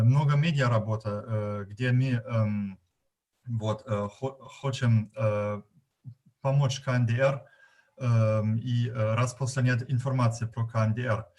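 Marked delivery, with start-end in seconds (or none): 1.7-11.3 Russian with a German accent; non-native Russian, German accent. Real, not acted. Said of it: "если мой русский не очень нормативный".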